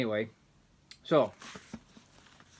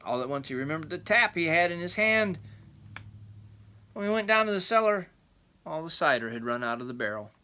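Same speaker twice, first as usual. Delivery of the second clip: rambling grandpa story; 4 semitones higher, 3 dB louder than usual. A narrator's reading. Reading the folk tale aloud.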